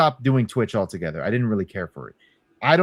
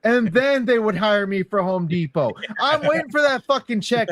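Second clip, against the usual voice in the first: mock indignant; 11 semitones higher than usual. Joking along in mock outrage.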